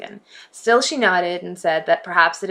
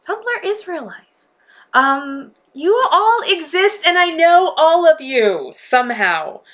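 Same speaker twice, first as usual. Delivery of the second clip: much higher, slow and playful; 10 semitones higher, 5 dB louder, slower than usual. Pretend. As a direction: sing it out high and grand. Playful grand claim to followers.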